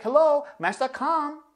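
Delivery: high, loud mock swoon at a dating site. Vlogger mocking the ice couple, high mock swoon. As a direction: squeal it like a matchmaking ad.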